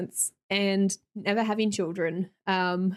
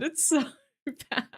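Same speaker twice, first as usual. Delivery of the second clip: laughing; 5 semitones higher than usual. Real, not acted. Repeats while laughing.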